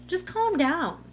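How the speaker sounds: patronizing mock "calm down"; dismissive calm-down. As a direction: say it like the worst possible advice. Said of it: "that is the worst thing".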